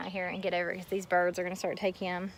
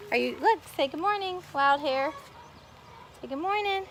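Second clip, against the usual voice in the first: higher, sing-song coaxing of a little one; 7 semitones higher than usual. Real, not acted. Coaxing child to greet viewers.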